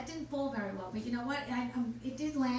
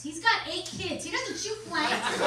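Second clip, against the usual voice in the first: punchline; 6 semitones higher, 5 dB louder, slower than usual. Acted. Punchline, louder and slow.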